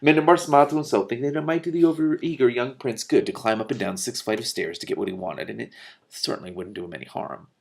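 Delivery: usual speaking voice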